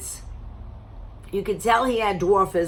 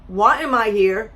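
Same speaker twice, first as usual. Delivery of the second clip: ordinary talk, nothing marked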